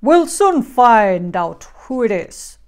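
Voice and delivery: loud, firm big bear voice; Father Bear. A narrator's reading. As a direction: announce it loudly as Papa Bear. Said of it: "said Father Bear".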